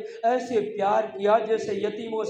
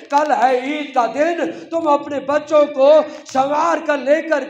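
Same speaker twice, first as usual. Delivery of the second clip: loud, higher, wailing sermon voice; impassioned lamenting recitation; preaching at full force; 8 semitones higher, 10 dB louder than usual. Acted. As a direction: cry out the last will to the mourners.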